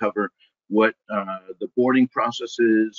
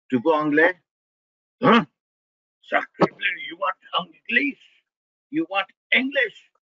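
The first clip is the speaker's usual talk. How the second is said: mock French exasperation, rising high; hammy Frenchman, pitch jumping up; French chef; 7 semitones higher than usual.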